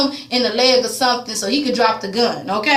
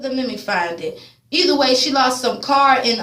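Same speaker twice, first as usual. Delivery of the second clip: ordinary talk, nothing marked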